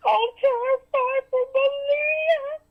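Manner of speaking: high, slow, mock-tearful voice; sarcastic falsetto "I'm terrified for Malia"; mocking fake fear. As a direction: parody a fake grieving mother. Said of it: "and she's like".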